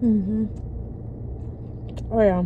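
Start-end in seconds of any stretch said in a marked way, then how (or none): none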